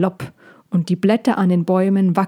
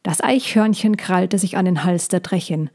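ordinary delivery

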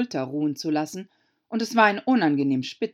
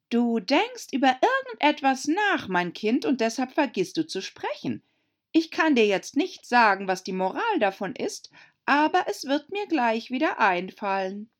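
a higher, put-on duchess voice close to the ear; Herzogin, aufdringlich; 7 semitones higher, 3 dB louder than usual. A narrator's reading. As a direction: lecture the child in a nosy sing-song. Herzogin dicht am Ohr. (Herzogin belehrt Alice dicht am Ohr, verstellt)

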